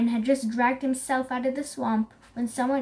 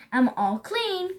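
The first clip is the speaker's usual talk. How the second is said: higher-pitched, pleased announcement; excited cry; 6 semitones higher than usual. A narrator's reading. Zebra shows Elephant the bath worked, excited cry. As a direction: announce it proudly to a friend. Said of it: "cried Zebra".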